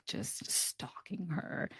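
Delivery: whispered, drawn out; slow, eerie whisper on the stalking; hushed dread. Real, not acted. Friend recounting the scariest part of the movie, hushed dread.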